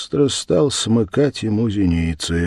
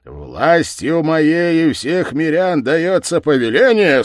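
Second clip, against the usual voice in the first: slow, loud, raised proclamation; village head's decree; 7 semitones higher, 6 dB louder, slower than usual. Acted. Village head issues an order, loud and ponderous. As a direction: proclaim it with full authority. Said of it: "сказал голова".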